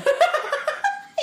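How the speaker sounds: high squealing laugh. Real, not acted. Snorer won't roll over.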